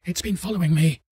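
terrified, being chased and begging; panicked and hysterical. Acted. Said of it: "Panicked and hysterical".

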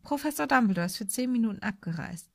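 plain reading voice

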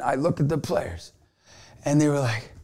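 ordinary talk

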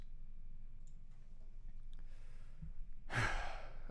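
long soft breathy sigh; quiet unvoiced sigh. A narrator's reading. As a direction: let out a tired sigh. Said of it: "I sighed".

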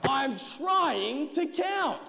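loud, high, drawn-out protest; exasperated shout over the noise; yelled. A narrator's reading. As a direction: yell it slowly, stretching the words. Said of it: "Zacchaeus yelled".

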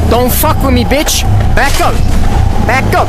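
high, loud threatening yell; angry yell. Pretend. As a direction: scare him off with a yell. Yelling at an attacking character.

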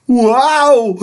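high, loud gleeful yell; kids yelling. Acted. The kids cheer that their teacher is absent, a loud high yell. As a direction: shout like kids who just got free. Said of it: "yelled the kids".

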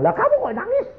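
very high, squeaky comeback; mock astonished retort. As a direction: throw the question back, comically high-pitched. Retold exchange between weeping companions. (menirukan balasan kaget dengan nada tinggi)

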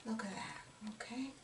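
very soft, near-silent check-in; whispering. Real, not acted. Painter showing viewers a light stroke, whispering. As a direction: whisper it so the hand stays light. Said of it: "I'm whispering because it helps me go light".

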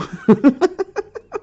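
high, loud laugh. Real, not acted. Streamer laughing after singing about the bayou, high laugh. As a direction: burst into a high-pitched laugh.

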